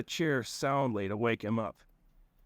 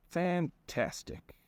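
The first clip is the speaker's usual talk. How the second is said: very slow sarcastic fantastic; 6 semitones higher, much slower than usual. Acted. Narrator's dry reaction.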